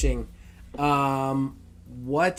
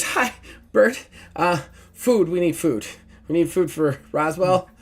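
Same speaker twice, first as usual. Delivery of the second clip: nervous Lila; 4 semitones higher, 4 dB louder than usual. Acted.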